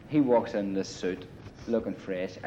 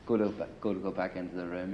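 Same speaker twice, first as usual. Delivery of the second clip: ordinary delivery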